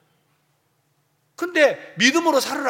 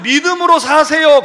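preaching line; 6 semitones higher, 7 dB louder than usual. Shown as a demonstration. Pastor voiced to congregation.

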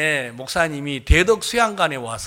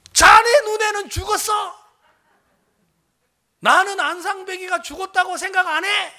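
high, loud scolding yell; shouted rebuke; 12 semitones higher, 4 dB louder than usual. Retold or imitated. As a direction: thunder back at a doubting junior. Senior pastor's shouted rebuke, re-enacted.

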